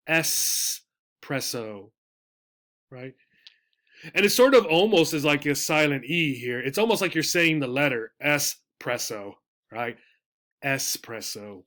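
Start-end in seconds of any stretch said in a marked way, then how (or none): 0.1-1.9 espresso drawn out slowly, es-pres-so, syllable by syllable; slow careful espresso. Shown as a demonstration. Teacher modelling the word slowly for students. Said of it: "The pronunciation is espresso". 10.6-11.6 Espresso said low and soft, slowly, es-pres-so; a soft, unhurried final model of Espresso; quiet model espresso. Shown as a demonstration. Teacher repeating the word once more for students. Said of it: "The pronunciation is espresso".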